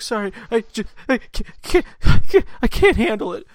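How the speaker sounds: high, stuttering crybaby voice; mock sobbing. Man imitating Katie's needle panic, exaggerated sobbing. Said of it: "She cries".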